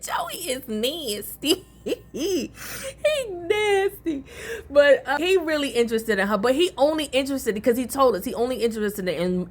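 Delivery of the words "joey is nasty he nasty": slow, laugh-choked verdict; amused disgust. Real, not acted. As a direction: giggle through the words. Laughing at the character.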